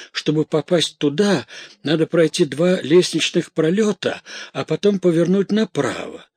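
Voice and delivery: high, excitable chattering voice; Bianca, rattled maid. A narrator's reading. Maid recounting her errand in detail, flustered. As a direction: ramble on in nervous agitation.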